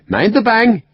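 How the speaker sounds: high, bright and amused; cheerful high warning to the wounded lord; happy warning. A narrator's reading. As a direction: tease him lightly before firing. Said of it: "said happily".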